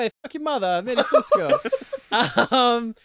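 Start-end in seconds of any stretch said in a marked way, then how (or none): 0.0-1.6 loud, high mock New York accent; comic New York tough talk, pitched up; Eddie Fusco. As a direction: ham up a brash New York accent, laughing. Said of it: "another one of my bang on accents".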